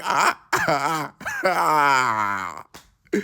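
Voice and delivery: imitated groans of pain. Friend after a bad landing, mock pained groaning. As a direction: groan like a hurt friend on the ground.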